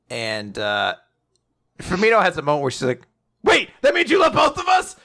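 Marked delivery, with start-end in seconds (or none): 3.4-5.0 high, mock-shouted question; imitating a startled, high-pitched outburst; shocked realisation. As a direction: blurt it out in hammed-up disbelief. Acts character's shocked realisation.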